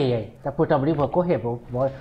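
ordinary talk

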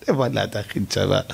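King Solomon scoffs with a laugh. Acted. Solomon laughs at the ant's offer, scoffing through laughter. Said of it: "שלמה המלך התחיל לצחוק".

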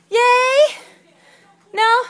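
coaxing. Real, not acted. Coaxing baby to cheer.

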